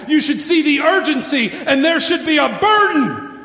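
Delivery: loud, high, driving sermon climax; preaching at full force. Acted. Pastor urging the church to save their families, loud preaching. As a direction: preach it hard, pleading with the church.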